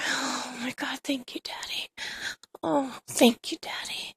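hushed, breathy gratitude; breathless thanks. Retold or imitated. Narrator replays thanking partner while overcome, breathlessly hushed. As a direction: let the voice sink almost to a whisper.

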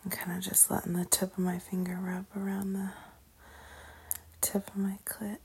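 soft breathy whisper; intimate whisper. Acted. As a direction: whisper it close to the mic. Intimate whispered self-narration.

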